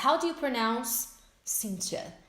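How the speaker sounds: slow, deliberate phrasing; slow model question. Shown as a demonstration. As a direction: model it for students.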